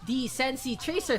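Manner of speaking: excited esports casting; play-by-play. Acted. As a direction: call the action fast and loud.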